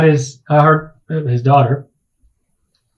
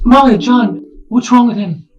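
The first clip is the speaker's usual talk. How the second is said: higher, anxious question; worried plea; 8 semitones higher than usual. Acted. Risa asking the party what is wrong with her father, anxious. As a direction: ask the others for help, anxious. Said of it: "she looks at you all and she says".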